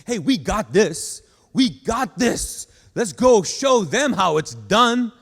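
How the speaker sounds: loud, slow, rousing pep talk. Shown as a demonstration. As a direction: fire up the team. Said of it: "motivate someone to join your team".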